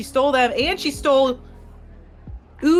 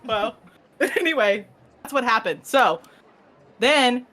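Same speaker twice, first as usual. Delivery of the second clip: normal talking voice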